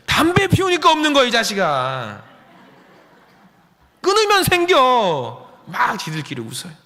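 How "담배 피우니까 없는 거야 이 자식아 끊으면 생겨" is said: loud playful jab, stretched slow at the end; mock scold to a teen; teasing retort. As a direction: rib the kid with a grin. Pastor teasing smoking teens.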